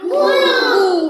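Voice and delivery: high, loud squeal of a hurt monkey; monkey shriek. Shown as a demonstration. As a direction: shriek like a monkey whose tail got stepped on. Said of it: "तो माकड कसा ओरडला?".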